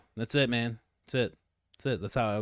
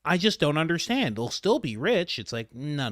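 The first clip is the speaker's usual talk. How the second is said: usual speaking voice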